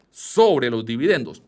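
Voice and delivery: "sobre" drawn out and punched, "los dividendos" falling after it; slow, weighty hit on "sobre" in "sobre los dividendos"; stress on "sobre". Real, not acted. Teacher explaining the US tax to investors, stressing "sobre". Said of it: "aquí hago mucho énfasis en".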